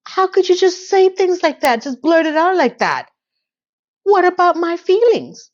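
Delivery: mock hurt outrage. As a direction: play a wounded, offended friend, a bit hammed-up. Charlotte upset at the psychic.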